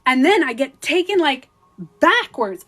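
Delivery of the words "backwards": usual speaking voice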